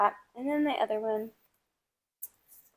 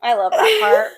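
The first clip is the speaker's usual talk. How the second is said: laughing high; 12 semitones higher, 12 dB louder than usual. Real, not acted. Laughing reaction.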